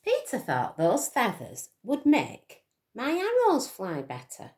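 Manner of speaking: ordinary delivery, nothing marked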